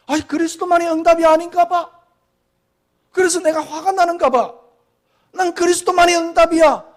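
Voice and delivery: high, loud imitated inner voice; mock self-talk. Pastor mocking a believer's inner voice, high and loud. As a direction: ham up a believer talking himself round. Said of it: "그렇게 하는 자체가 뭐냐니까".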